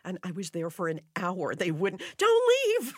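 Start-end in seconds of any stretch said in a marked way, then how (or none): 2.2-2.9 pleading. Retold or imitated. Audience begging her to stay.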